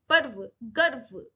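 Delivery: पर्व, गर्व slowly, as "parv, garv"; no vowel after r in पर्व, गर्व; light र. Shown as a demonstration. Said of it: "हल्के से बोलना".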